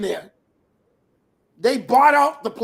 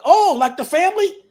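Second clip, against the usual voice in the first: higher, louder astonished question; surprised realization; 6 semitones higher, 5 dB louder than usual. Retold or imitated. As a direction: retell it as a sudden, amazed realization. Startled at learning the family name.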